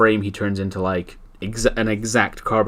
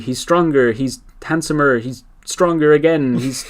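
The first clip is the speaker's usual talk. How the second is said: mock jealous whine; 7 semitones higher, 5 dB louder than usual. Jealous rich guy rants.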